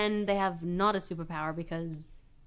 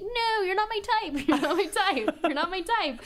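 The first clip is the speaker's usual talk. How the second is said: much higher, sassy brush-off voice; picky queen bee; 9 semitones higher than usual. Acted. Queen bee rejecting the swarming male bees in a high sassy voice. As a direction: turn down the suitors like a fussy diva. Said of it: "she's like".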